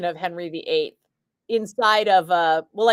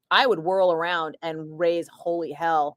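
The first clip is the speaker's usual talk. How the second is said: usual speaking voice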